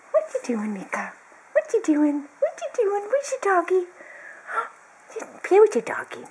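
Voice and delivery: high, sing-song pet voice. Real, not acted. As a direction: coo at the puppy playfully. High pet talk to the dog.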